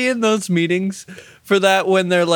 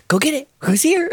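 dog owner talk; 10 semitones higher, faster than usual. Retold or imitated.

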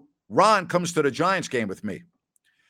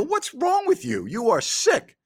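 much higher, incredulous exclamation; retold shocked disgust; 12 semitones higher than usual. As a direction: recoil at your friend's gross prank. Retelling his own disgusted reaction.

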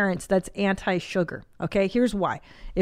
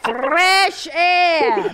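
put-on higher, louder Hungarian-accented mother; Hungarian mother's accent; 8 semitones higher, 5 dB louder than usual. Echoing his mother's saying for the Russian caller, Hungarian accent. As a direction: declare it like an old Hungarian mom. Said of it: "I say it in my mother's accent".

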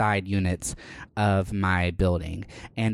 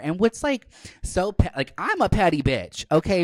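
higher, worked-up indignation; 9 semitones higher than usual. Real, not acted. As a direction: get riled about it. Excited about neighbor's pettiness.

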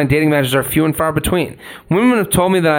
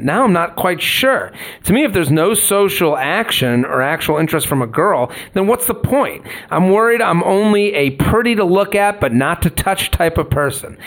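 ordinary delivery

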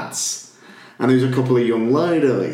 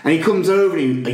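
usual speaking voice

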